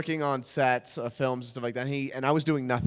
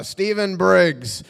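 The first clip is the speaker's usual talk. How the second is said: drawn-out, louder name call; casting caller; 5 dB louder, much slower than usual. Retold or imitated. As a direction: call out the next name slowly. Casting man calling out a name.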